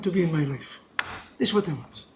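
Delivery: low, soft, heartfelt plea; quiet prayer. Acted. Worshipper asking God into their life, quiet prayer. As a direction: let the voice sink low and soft.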